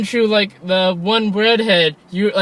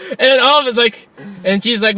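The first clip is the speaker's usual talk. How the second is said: ordinary talk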